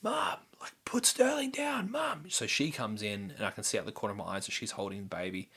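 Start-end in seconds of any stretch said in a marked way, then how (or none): 0.0-2.3 high frantic yell, half whispered in parts; screaming for Mum. Retold or imitated. Husband calling Mum in a panic, high frantic yell. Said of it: "I screaming out to Mum".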